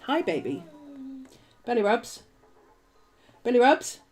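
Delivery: high, sing-song coo to a pet; sweet talk to the dog up high. Real, not acted. Cooing to her dog.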